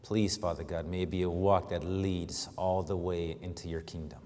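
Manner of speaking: hushed, reverent pulpit prayer. Real, not acted. Leads the congregation in prayer.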